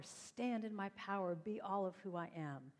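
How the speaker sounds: ordinary delivery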